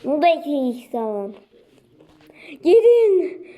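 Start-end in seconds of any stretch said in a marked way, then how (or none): 2.6-3.5 short loud high command; urgent shout. Real, not acted. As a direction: shout it at your troops. Urging troops in game.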